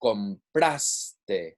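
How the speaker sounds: "Compraste" with "pras" stressed; stress before last syllable. Shown as a demonstration. Teacher showing no accent is needed, "Compraste" stressed on "pras". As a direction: lean on the middle of "Compraste", drop off "te". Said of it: "Not on the last syllable".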